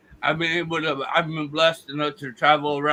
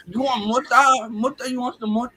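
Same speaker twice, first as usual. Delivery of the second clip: raised, insistent hustler voice; Mexican street dealer; 7 semitones higher, 3 dB louder than usual. Retold or imitated. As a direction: push the offer like a Mexican street seller. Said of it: "he looks like a fucking Juanito".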